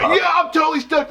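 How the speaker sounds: high, stunned flat stoked; dazed fan. Retold or imitated. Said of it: "And I'm like".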